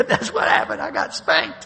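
loud, high punchline through laughter; laughing confession. Retold or imitated. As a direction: blurt it out, cracking up. Comic punchline of his own story.

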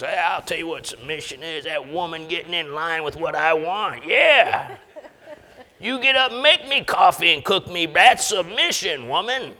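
hammed-up chauvinist husband, smug and bossy; mock macho husband. Pastor mocking a macho husband to the church, in a cocky, bossy voice.

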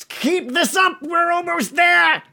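high, loud goblin shout. Acted. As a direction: yell it across the arena.

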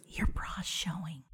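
slow, hushed confidential whisper. Retold or imitated. Woman in a store discreetly warning a stranger about her bra, whispering. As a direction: breathe it out as a secret warning. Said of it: "And whispers".

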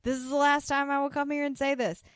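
high-pitched imitation of a yelled order; mock yelling. Podcaster voicing a fake envoy ordering everyone out, mock yelling. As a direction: proclaim it like an exasperated official, half yelling. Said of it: "you're basically yelling into".

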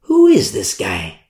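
low, slow, doubtful mutter; low suspicious. Acted. Leaders eyeing Paul, low and slow distrust. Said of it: "religious leaders like".

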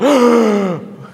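big mock gulp of breath after nonstop talk; exaggerated gasp for air. Mimicking the fast talker finally breathing, loud gasp. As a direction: suck in air loudly, as if starved of it. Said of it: "doesn't even take a breath".

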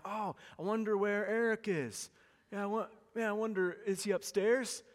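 feigned innocence. Retold or imitated. Pastor acting coy sitting on kids.

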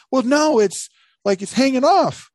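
high, loud imitation of an insistent friend; mock Dan. Storyteller acting out his friend pointing at damage, high and insistent. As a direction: push it up high, mimicking his objection. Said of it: "and you go".

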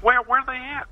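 high, stumbling imitation of an eager questioner; mock amazed questioning. Writer swallowing the armadillo prank, mock amazed.

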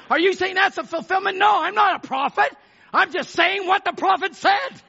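high-pitched, forceful pulpit cry; full-force preaching. Real, not acted. Preacher defending the prophet's words to church, full-force preaching. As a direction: drive each phrase home to the congregation.

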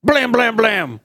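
loud mouth gunshots, blam blam; gunshot noises. Pretend. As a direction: bark out fake gunfire. Imitating gunshots at the man.

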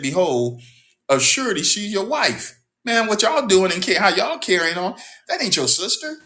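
high, loud indignant scolding; indignant confrontation. Acted. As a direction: call him out, outraged. Abimelech confronts Isaac.

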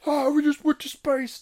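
high, loud hammed-up "Oh, we just went to space"; hammy mocking take on "went to space". Shown as a demonstration. Mocking the film's characters, hammed-up. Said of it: "mocking repeat".

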